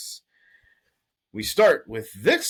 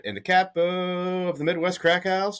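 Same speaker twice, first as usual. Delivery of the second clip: hype intro; 9 semitones higher than usual. Acted. Hyped host introduction.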